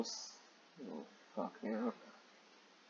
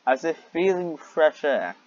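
louder, mocking reread of the line; incredulous reread; 3 semitones higher, 7 dB louder than usual. Real, not acted. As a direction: repeat it in loud disbelief.

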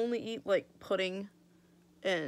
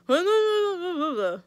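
loud, high numb-mouthed babble; hammed-up swollen-lip gibberish; imitated garbled talk; 10 semitones higher, 11 dB louder than usual. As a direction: babble loudly as if unable to form words. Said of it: "Like, I can't talk".

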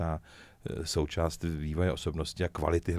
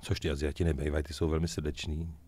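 ordinary talk

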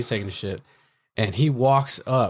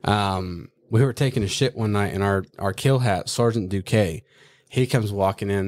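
ordinary delivery

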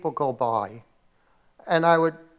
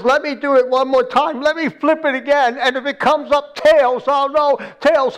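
high, loud retold plea, mock-tearful; acting out a weeping man's bargain with God; tearful pleading; 8 semitones higher, 5 dB louder than usual. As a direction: plead desperately, voice raised. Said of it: "he's crying and weeping".

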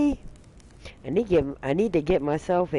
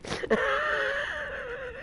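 high shrieking laugh; 6 dB quieter than usual. Real, not acted. Laughing hard.

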